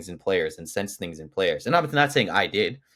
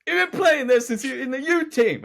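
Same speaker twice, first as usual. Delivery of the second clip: mocking voice; 5 dB louder than usual. Mocking a fan's rant.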